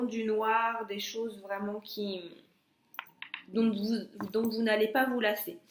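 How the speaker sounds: normal talking voice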